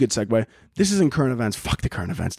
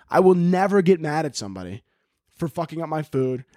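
ordinary talk, nothing marked

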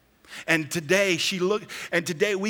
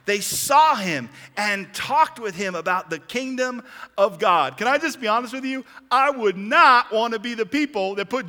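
raised, insistent preacher voice; forceful preaching; 5 semitones higher, 4 dB louder than usual. Real, not acted. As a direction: preach it out, pitched up and emphatic.

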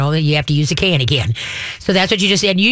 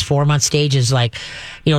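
ordinary talk

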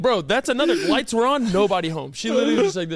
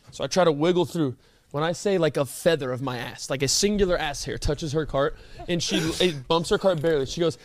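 ordinary talk

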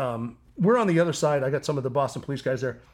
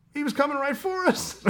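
mock shouted excuse; 11 semitones higher than usual. Brother's deadpan excuse retold.

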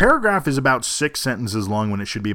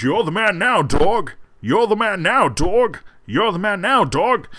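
high-pitched imitation of a movie catchphrase; Sean Connery quote; 7 semitones higher than usual. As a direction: echo the looping movie clip line. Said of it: "was the sound clip from the movie".

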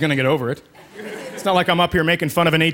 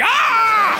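high exasperated yell. Acted. Exasperated outburst.

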